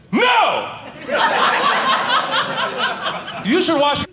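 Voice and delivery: high-pitched yell cracking into a laugh; mock yell. To the unwashed guy in Whole Foods, high mock yell. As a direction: yell it at him, losing it laughing. Comic yelling hygiene lecture at stranger.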